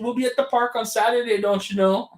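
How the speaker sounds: a bad Minnesota impression on "don't you know"; terrible Minnesota accent. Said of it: "that part was a terrible Minnesota accent".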